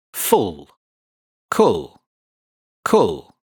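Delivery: "Cull" said like pull, kul; rhyme model. Shown as a demonstration. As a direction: drill "Cull" to rhyme with "Full". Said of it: "The second syllable is pronounced like pull".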